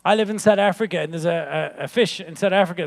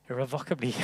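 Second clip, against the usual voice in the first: quiet retry; 8 semitones lower, 11 dB quieter, faster than usual. Shown as a demonstration. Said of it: "I think I got the word wrong".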